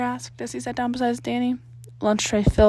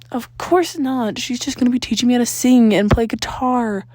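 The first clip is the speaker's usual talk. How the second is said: ordinary delivery, nothing marked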